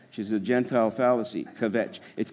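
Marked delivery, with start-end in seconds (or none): none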